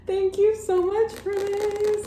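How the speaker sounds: high, squealed gratitude; gushing thanks. Real, not acted. Vlogger thanking a friend for the manga, gushing squeal.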